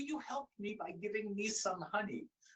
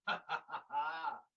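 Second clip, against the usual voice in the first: panther laugh. Acted. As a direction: chuckle like a sly big cat.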